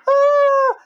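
high sudden yelp of fright; mock scream. Bus rider yelps at the drop, high mock scream. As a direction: yelp like the bus is tipping over the edge. Scared on cliff-edge bus ride.

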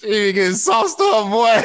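high and loud, hyped-up ribbing; loud joking shout. Real, not acted. Teasing about her wine on the show, loud shout. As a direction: shout the joke to the whole table.

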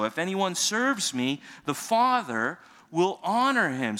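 rising, emphatic scripture read. Acted. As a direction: proclaim the verse to the congregation. Reads scripture aloud.

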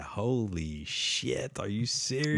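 slow, drawn-out shocked reaction; imitating his own jaw-drop at the screen; stunned disbelief. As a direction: let the shock stretch each word out.

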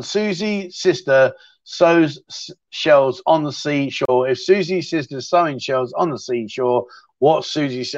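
slow, careful recital of a tongue twister. Shown as a demonstration. Recalling the Susie tongue twister for viewers, slowly. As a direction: pick the words out slowly, one by one. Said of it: "What's that one".

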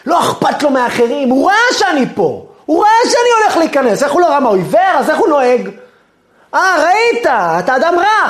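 a driver boiling over at being cut off; escalating angry shouting at the other driver; road rage rant. Pretend. Enraged driver over parking spot.

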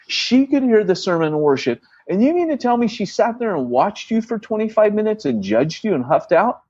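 rising, high-pitched outrage building on; indignant rant. Retold or imitated. Pastor to his team, incredulous and indignant. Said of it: "that shepherd bone kicked in".

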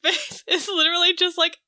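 ordinary talk, nothing marked

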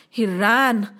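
ordinary delivery, nothing marked

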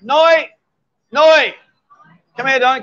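high, loud call across the bar; shouting a name across the room. Real, not acted. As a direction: call her over from a distance. Calling a woman over.

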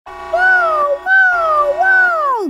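high siren wail; mock alarm siren. Alarm going off.

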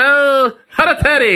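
high, loud mock accent on hereditary; mock Chinese accent. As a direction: imitate his accented attempt, laughing. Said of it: "You said".

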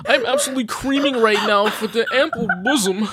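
Jordan Peterson. Acted. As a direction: gush theatrically in an exaggerated put-on voice. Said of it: "if we added creaming with Jordan Peterson".

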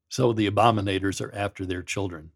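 ordinary talk, nothing marked

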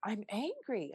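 hushed acceptance of the feeling. Retold or imitated. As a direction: let it land, almost to yourself. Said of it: "I caught myself and I said".

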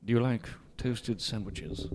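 slow, low seductive actor voice; lightly put-on suave star, drawing out each word; imagined star conversation. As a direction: play toasted sandwiches as sultry talk. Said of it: "how would that sound".

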